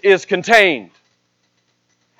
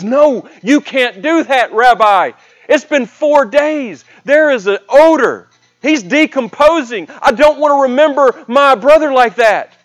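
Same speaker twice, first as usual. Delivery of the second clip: higher-pitched, insistent objection as Martha; distressed protest; 6 semitones higher than usual. Retold or imitated. Martha objects to opening her brother's tomb, distressed protest. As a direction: plead against it, pitch raised, words stretched.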